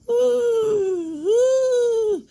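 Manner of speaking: high, drawn-out cartoon ghost "ooh"; ghostly wail. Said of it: "those ghosts going".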